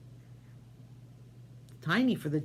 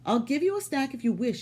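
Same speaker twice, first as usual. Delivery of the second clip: higher, lightly voiced wife; giant's wife; 8 semitones higher, 4 dB louder than usual. Wife offering Jack food, lightly higher voice. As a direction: offer it kindly, a bit higher. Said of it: "she said".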